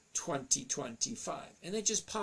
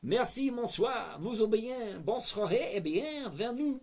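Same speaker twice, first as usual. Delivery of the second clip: put-on French accent; 6 semitones higher, 3 dB louder than usual. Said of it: "I like your French accent".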